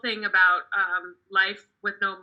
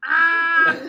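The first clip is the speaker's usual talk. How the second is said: higher, louder shriek of delight; 8 semitones higher, 8 dB louder than usual. Real, not acted. Comic cracking up at her joke, delighted squeal. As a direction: let out a happy squeal. Exclaims after punchline.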